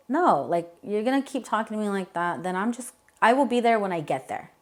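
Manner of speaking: defiant wife raising her voice to him on the phone. Retold or imitated. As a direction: push back hard at him, voice climbing. Defiant retort to angry husband.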